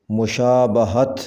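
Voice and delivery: مشابہت with a short a after b, "mushaabahat"; correct pronunciation. Shown as a demonstration. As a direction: say مشابہت as mu-shaa-BA-hat. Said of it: "صحیح تلفظ ہے".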